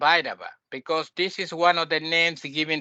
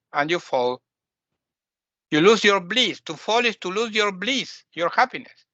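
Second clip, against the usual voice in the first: normal talking voice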